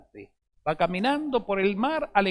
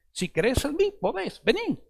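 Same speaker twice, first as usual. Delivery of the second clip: higher, lightly voiced Jesus calling Peter to come; Jesus inviting Peter; 8 semitones higher than usual. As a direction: lift the voice up as a gentle summons. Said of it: "¿Y qué le dice Jesús?".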